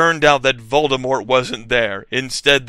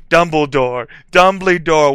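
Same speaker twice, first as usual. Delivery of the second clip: loud calling out, rising higher; yelling; 4 semitones higher, 8 dB louder than usual. A narrator's reading. Students yelling up the stairs for Dumbledore, loud call. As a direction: call out louder the second time. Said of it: "we both yelled".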